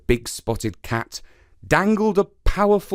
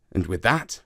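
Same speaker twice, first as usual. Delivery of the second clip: ordinary delivery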